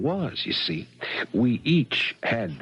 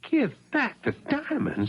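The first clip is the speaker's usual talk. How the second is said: incredulous; 13 semitones higher than usual. Acted. Thief told to return the diamonds.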